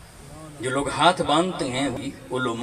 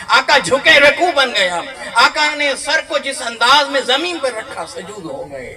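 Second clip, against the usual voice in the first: much higher and louder impassioned preaching with laughter; fervent preaching; 12 semitones higher, 12 dB louder than usual. Acted. Preacher to congregation, soaring fervent preaching. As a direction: lift the hall with joyful fervour.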